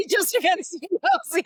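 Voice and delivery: high, loud echo through real laughter; laughing. Real, not acted. Agreeing with the host's needle joke, laughing. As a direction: repeat it while cracking up.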